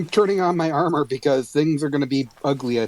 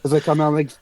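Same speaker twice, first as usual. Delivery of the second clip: normal talking voice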